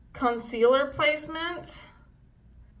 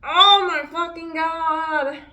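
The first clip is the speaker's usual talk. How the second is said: slow, higher, loud oh my fucking God; loud shocked groan; 6 semitones higher, 7 dB louder, slower than usual. Real, not acted. Vlogger seeing her makeup fail, loud and shocked. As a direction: drag it out in disbelief.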